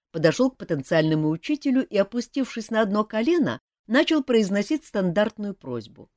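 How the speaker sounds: ordinary delivery, nothing marked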